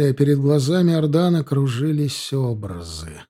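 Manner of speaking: ordinary delivery, nothing marked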